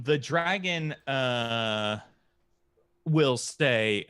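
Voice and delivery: ordinary talk, nothing marked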